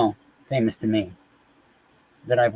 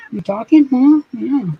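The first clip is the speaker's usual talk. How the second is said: high squeaky voice for the cat; high-pitched pet talk; cat baby talk; 12 semitones higher, 6 dB louder than usual. Acted. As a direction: coo at the kitty like a baby. Talking to the cat.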